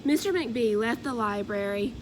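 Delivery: plain reading voice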